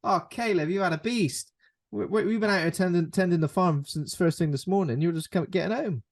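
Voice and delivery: mildly hammed-up parental annoyance; mock angry parent. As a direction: tell off a wayward son, playfully. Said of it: "I'd be mad at him".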